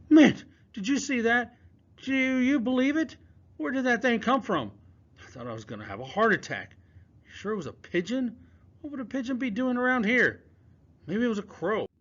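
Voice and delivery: put-on high, jittery young boy voice; a shaken kid babbling after a scare; rattled boy talking fast and high; nervous boy Palmer. Palmer's voice in the story.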